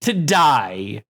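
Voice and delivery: ordinary talk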